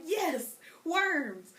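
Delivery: put-on cheerful child answering the rain; kid voice. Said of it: "So I'd be like".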